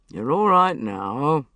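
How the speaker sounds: slow, steady soothing tone; calm, reassuring. Acted. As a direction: talk her down gently, slowly. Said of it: "in a calm, reassuring voice".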